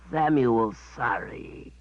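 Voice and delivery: sheepish beast apology; guilty creature voice; sorry creature. Acted.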